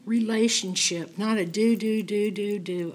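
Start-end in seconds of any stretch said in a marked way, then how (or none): none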